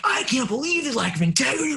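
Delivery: high-pitched re-enacted teacher's rant; ranting scolding. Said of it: "I lit into it".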